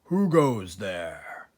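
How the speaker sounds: low, slow booming call from above; slow and deep, a hidden stranger; deep unseen voice; deep badger voice. A narrator's reading. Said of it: "a deep voice above their heads said".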